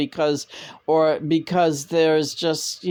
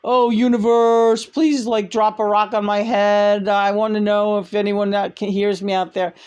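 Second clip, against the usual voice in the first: high, pleading mock prayer to the universe; louder, higher mock plea; mocking whiny seeker; 5 semitones higher, 3 dB louder than usual. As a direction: whine at the sky like a needy seeker. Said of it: "These people are like".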